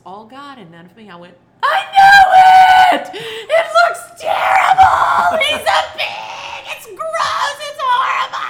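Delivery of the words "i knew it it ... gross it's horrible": very high, loud, slow mock wail; hammed-up copy of her crying fit; mimicking her wailing. Mother echoing her daughter's meltdown over the dog, loud mock wail. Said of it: "acted just like she acted".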